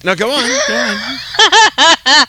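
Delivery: slow, laughing urging. Real, not acted. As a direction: egg them on through laughter.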